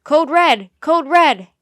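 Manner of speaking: barked emergency alert; urgent shout. A narrator's reading. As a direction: shout an emergency code over the radio. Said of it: "a man's voice shouted".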